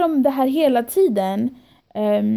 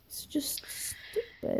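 breathless whisper, slow and drained; whispered aside; 5 semitones higher, 17 dB quieter, much slower than usual. Real, not acted. Venting to her friend about the staff, in a whisper. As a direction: let it out under your breath, worn out.

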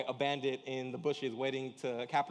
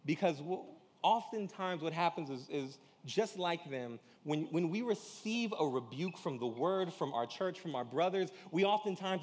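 normal talking voice

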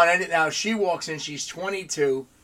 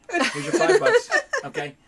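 laughing mockery; 5 dB louder than usual. Mocking departing worker.

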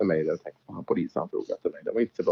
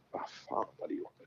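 breathy whispered inner thought; thinking aloud; 18 dB quieter than usual. Retold or imitated. Driver voicing his private thought in a whisper. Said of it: "tänkte jag".

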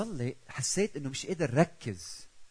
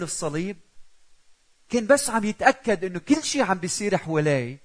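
higher, louder searching plea to the Father; preacher voicing Jesus in Gethsemane, earnest and louder; pleading Jesus; 7 semitones higher, 9 dB louder than usual. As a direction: ask it like a son checking with his father. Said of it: "عم يحكي مع بيو، عم يتأكد".

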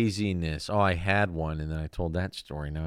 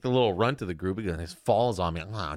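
ordinary talk, nothing marked

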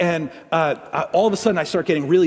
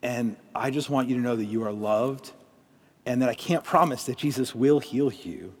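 quiet, lower, slow heartfelt prayer; soft prayer; 5 semitones lower, 7 dB quieter than usual. Acted. A pastor prays softly over hurting listeners in a lowered, slow voice. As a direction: pray over them gently, pausing often.

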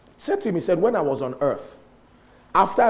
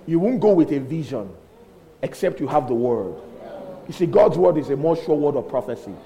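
ordinary delivery

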